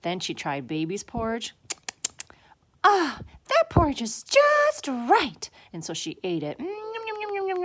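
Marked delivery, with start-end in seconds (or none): 2.8-5.5 high, loud, drawn-out delight; delighted Goldilocks. A narrator's reading. Goldilocks finding the perfect porridge, high delighted voice. 6.6-7.6 high, run-together yummy munching; greedy gobbling. A narrator's reading. Goldilocks eating till it's all gone, high gobbling.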